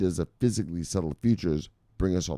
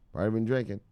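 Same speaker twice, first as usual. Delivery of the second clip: usual speaking voice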